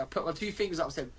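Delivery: ordinary delivery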